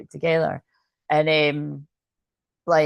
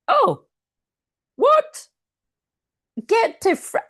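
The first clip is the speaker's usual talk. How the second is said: high, loud gasp of disbelief; startled; 4 dB louder, slower than usual. Real, not acted. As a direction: blurt it out in shock, pitch leaping up. Startled by what she reads.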